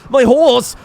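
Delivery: loud, high shout; alarmed cry. Real, not acted. Player suddenly seeing the horse in danger, alarmed shout. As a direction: burst out mid-thought in alarm.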